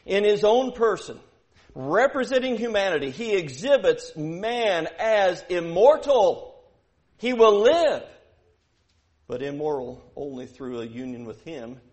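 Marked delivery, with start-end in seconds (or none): 0.0-8.0 loud and high, rising to a shout; preaching at full force. Acted. Preacher driving home his point to the church, loud and rising. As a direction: hammer each word at the congregation.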